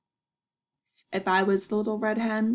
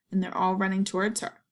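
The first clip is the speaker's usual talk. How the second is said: ordinary delivery, nothing marked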